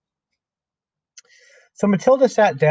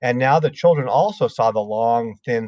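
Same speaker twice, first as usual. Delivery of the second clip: ordinary delivery, nothing marked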